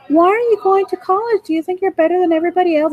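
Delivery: high, fast mocking imitation; sneering classmate. Mocking the high school friend who scorned college, high sneering voice. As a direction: mimic her sneer, pitched well up.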